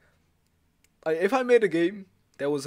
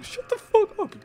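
high yell; 8 dB louder than usual. Real, not acted. Yelling at a friend.

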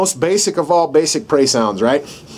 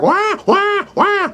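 far higher, panicked wailing wahs; frantic mock cry; much slower than usual. Shown as a demonstration. Instructor shows a prey animal being eaten, frantic wailing. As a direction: freak out, wail in terror. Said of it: "It's gonna freak out".

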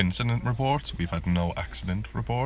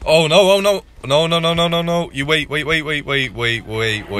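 high, loud panicked no's and waits; 8 semitones higher, 9 dB louder than usual. Real, not acted. Streamer watching his planes head for a crash, loud panicked pleading. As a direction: blurt it out in rising alarm as a crash looms.

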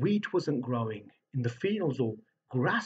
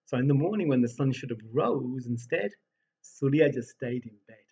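usual speaking voice

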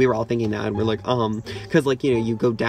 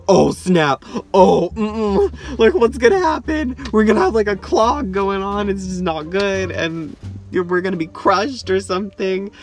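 mock panic; 7 semitones higher, 5 dB louder than usual. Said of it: "we're like".

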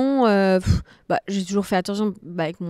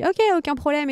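higher-pitched, quick yes; breezy agreement; 7 semitones higher than usual. Retold or imitated. New coach agreeing to a last-minute class, breezy and high. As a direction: say it high, as if it's no trouble. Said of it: "je dis".

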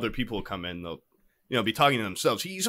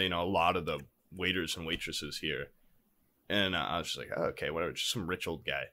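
quiet, low retold musing; 11 semitones lower, 12 dB quieter, faster than usual.

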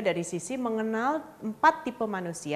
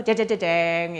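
imitated orchestral fanfare, loud; mock dramatic sting; 3 semitones lower, 5 dB louder, slower than usual. Coach mocking closing as a big event, dramatic sting. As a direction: sing out a mock Beethoven drum-roll sting. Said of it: "ada lagu Beethoven di belakang".

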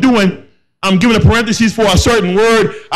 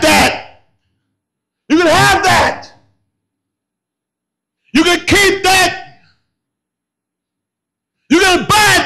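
preaching at full force; 8 semitones higher than usual. Acted. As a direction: build each line like a rising sermon climax.

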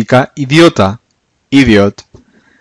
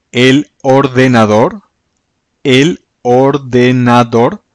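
teacher spelling out "el ordenador" by ear, slowly; slow pronunciation; slower than usual. Shown as a demonstration. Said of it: "teacher slows new word".

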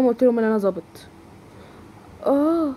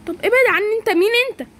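high, panicked pleading; frightened protest; 8 semitones higher than usual. Acted. Girl grabbed by a thug, frightened and high. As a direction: push him away in fear.

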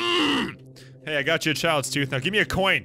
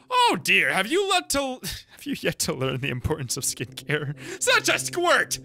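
higher, sniffy and scolding; haughty creature; 5 semitones higher than usual. Acted. Vain creature scolding the kid about skincare, sniffy and high. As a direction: look down your nose at the little squirt.

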